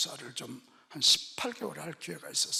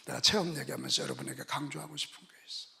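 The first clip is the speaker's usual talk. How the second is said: ordinary talk, nothing marked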